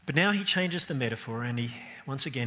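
ordinary talk

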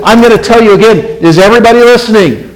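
loud, high acted call for attention; shouting to sleepy crowd. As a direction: call out to wake the back rows. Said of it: "he's shouting out to a sleepy crowd out there".

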